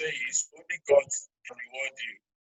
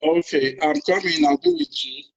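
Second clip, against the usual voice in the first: loud, raised voice on a bad line; raised voice down a poor phone line; calling out over bad connection; 13 semitones higher, 9 dB louder than usual. Real, not acted. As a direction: call loudly to reassure the host.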